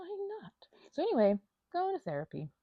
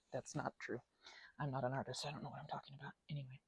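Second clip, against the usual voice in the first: whisper; 8 semitones lower, 16 dB quieter, faster than usual. Real, not acted. Self-deprecating whispered aside.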